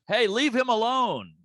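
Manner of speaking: high, slow call from the back; mock scolding. Guy in the back defending the heckler, mock scolding call. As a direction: tell him off from the back of the room. Audience member scolding comic.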